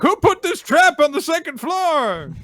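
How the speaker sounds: put-on very high, loud old-man outcry; hammed-up elderly yell; indignant old man. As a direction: holler in outrage like a confused old man. Old man nearly falls, complains.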